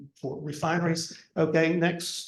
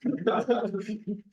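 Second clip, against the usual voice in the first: short laugh; 4 semitones higher than usual. Real, not acted. Laughing at old photo.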